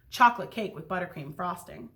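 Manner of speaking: ordinary delivery, nothing marked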